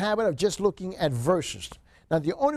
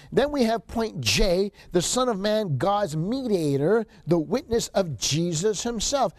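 normal talking voice

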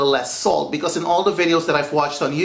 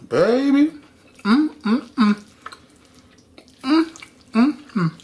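delighted mm; 8 semitones higher than usual. Real, not acted. Tasting food.

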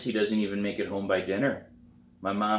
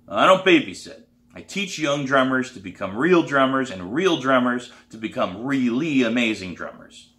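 loud, emphatic declaration, higher at the start; stern teacher; 4 semitones higher, 7 dB louder than usual. A narrator's reading. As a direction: lay down your standards forcefully. Teacher sets out his standards.

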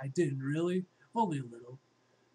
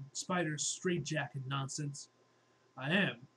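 usual speaking voice

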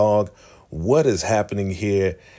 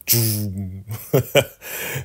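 laughing; 2 semitones higher than usual. Real, not acted. Laugh at the dead hog punchline.

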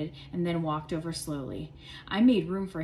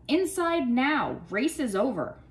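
stern teacher; 5 semitones higher, 4 dB louder, slower than usual. A narrator's reading. Teacher ordering class inside.

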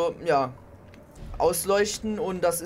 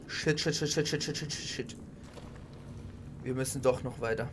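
ordinary talk

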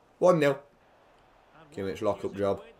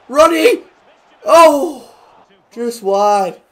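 high loud cry; shouting at the chance; excited shout; 9 semitones higher, 10 dB louder, much slower than usual. Real, not acted. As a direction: yell at the near miss. Near miss at goal.

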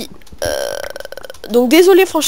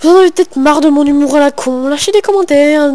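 mock outburst; 5 semitones higher, 6 dB louder than usual. Self-mocking outburst.

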